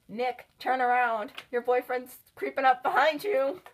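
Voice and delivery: loud, slowed call to Nick; mock warning shout. Warning Nick about Olsen creeping behind, loud mock shout. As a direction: yell a warning across the room at Nick. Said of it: "So I'm just like".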